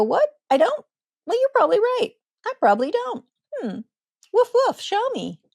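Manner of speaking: playful doggy. Acted. Parent playing a puppy for a snarling child, silly and high. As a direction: play the head-tilting puppy, woofing for fun. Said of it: "cock your head like a doggy and go".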